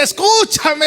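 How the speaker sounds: high, urgent call to the passer-by; wisdom shouting. Acted. Wisdom calling out to the fool, a high urgent shout. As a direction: cry out to make the fool listen. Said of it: "la sabiduría le grita".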